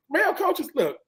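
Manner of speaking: ordinary talk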